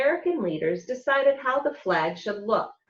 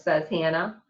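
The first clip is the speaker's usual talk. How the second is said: usual speaking voice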